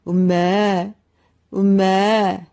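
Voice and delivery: loud mooing; the cow-man's shout coming out as moos. A narrator's reading. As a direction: moo out loud. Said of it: "목소리는 여전히".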